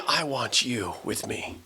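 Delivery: soft, slow, tender assurance; quiet loving invitation. Acted. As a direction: drop to a hushed, tender voice. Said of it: "looking at him in love and saying".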